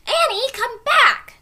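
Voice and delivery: slow loud high call. A narrator's reading. Jack calling his sister down the ladder, loud call. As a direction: call her back down the tree.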